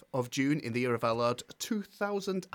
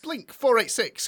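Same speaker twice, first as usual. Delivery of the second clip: announcing; 8 semitones higher, 5 dB louder than usual. Acted. Reading the clue.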